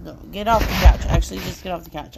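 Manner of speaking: firm loud command to a pet; stern loud telling-off; scolding the cat. Real, not acted. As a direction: shoo the cat off the couch, sharply.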